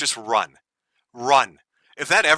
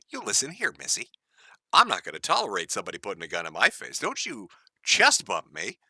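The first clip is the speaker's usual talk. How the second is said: mock scolding parent; 4 semitones lower, 7 dB quieter than usual. Scolding a woman like a child.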